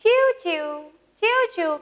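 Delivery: high, singsong "chiv chiv"; sparrow chirp. Shown as a demonstration. Teacher showing how a little sparrow calls, high chirps. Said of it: "चिमणी कसं ओरडते बरं".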